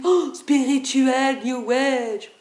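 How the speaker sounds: slow, drawn-out mock awe; mocking gush. Mimicking people who hear Shiva and think New Age, mocking. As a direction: stretch it out like a starry-eyed New Age devotee. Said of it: "on dit Shiva tout de suite".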